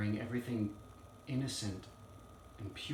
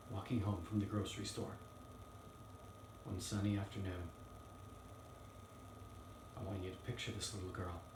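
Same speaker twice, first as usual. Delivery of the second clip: hushed courtroom storytelling; 2 semitones lower, 8 dB quieter, faster than usual. Acted. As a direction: softly lead the jury into the story. Lawyer asks jury to picture story.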